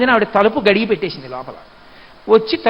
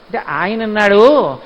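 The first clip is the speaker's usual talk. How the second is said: ordinary talk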